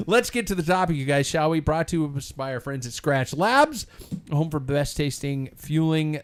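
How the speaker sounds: ad read. Acted. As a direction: sell it like a podcast ad spot. Sponsor ad read.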